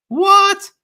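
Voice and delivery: shrieked high and loud in disbelief; outraged what. Real, not acted. Host reacting to a ridiculous tweet, shrieking what.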